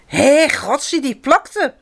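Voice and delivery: high-pitched startled disgust; storybook mouse's yucky shock; startled cry. A narrator's reading. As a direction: exclaim in startled disgust, voice jumping up. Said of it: "muis riep geschrokken".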